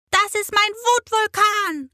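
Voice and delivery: put-on high, loud little girl's announcement; loud high child proudly calling out. A narrator's reading. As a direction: show off the drawing as a small girl. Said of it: "ruft sie".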